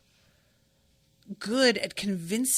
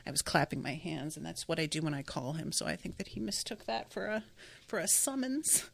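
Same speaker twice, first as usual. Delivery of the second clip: ordinary delivery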